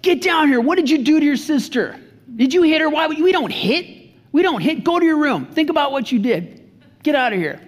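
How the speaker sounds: put-on angry dad yelling at his kid; retold yelling at kid. Dad yelling at a kid who hit his sister, angry. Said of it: "And then I just start yelling".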